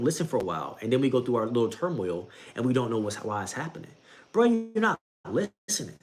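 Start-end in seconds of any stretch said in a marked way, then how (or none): 4.3-6.0 mock scolding. Scolding a listener who won't listen.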